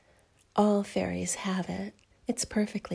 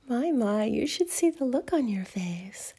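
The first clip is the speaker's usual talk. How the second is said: ordinary delivery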